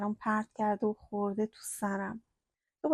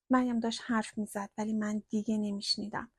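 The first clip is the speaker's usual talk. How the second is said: ordinary delivery, nothing marked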